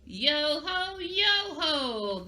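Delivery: high, jolly pirate chant; pirate laugh. Shown as a demonstration. Teacher showing how pirates laugh and have fun, piratey. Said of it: "how are we gonna laugh as a pirate?".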